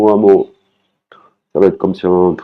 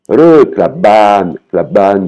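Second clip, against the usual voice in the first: slow demo; 4 dB louder, slower than usual. Shown as a demonstration. Teaching Thai phrase slowly.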